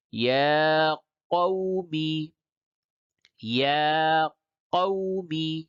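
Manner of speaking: slow chanted syllables with long held vowels; spelling-out recitation. Shown as a demonstration. Teacher drilling Qaida words by hijje, slow chanted recitation. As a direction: spell each letter, then draw out the joined word. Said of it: "یہ ہجے کر کے پڑھا گیا".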